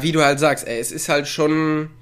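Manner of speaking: ordinary talk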